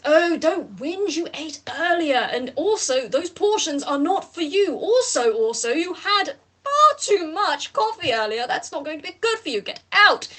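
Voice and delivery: lightly voiced fussy scold; mild scolding of the plant; scolding [name]. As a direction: nag like a put-upon carer. [name] scolds sulking Sol.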